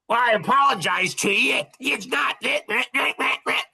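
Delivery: high, whiny mimicry of an apology; mocking fake apology. Normal person brushing off someone hurt, mocking high voice.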